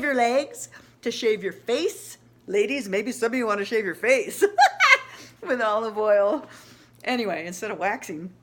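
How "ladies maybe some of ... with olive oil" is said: joking to the ladies while laughing through the words. Real, not acted. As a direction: laugh mid-sentence at your own joke, louder. Joke about women shaving faces.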